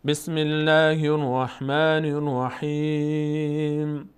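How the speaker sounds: full melodic tajweed chant; slow Quran recitation. Shown as a demonstration. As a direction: recite the sura together slowly.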